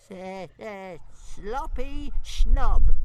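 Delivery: high, slow stuttering jeer. Acted. Toothless jeering at Fireworm, high stammer. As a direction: taunt her, stammering. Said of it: "jeered Toothless".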